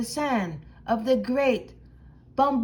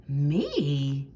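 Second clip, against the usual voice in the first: surprised squeak; 10 semitones lower than usual. Acted. Dog surprised being chosen.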